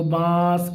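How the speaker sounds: بانس with a long nasal vowel; nasal nun. Shown as a demonstration. Teacher points out the nun to pupils, drawn-out nasal بانس. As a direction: hold the nasal sound inside بانس. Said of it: "نون کی آواز کس طرح سے آ رہی ہے".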